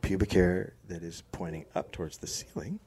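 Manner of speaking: low, hushed and soothing; soft and calm, no judgment; soft, gentle voice. Shown as a demonstration. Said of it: "you go like this".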